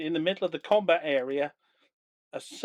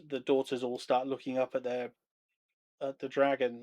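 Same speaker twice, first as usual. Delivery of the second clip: normal talking voice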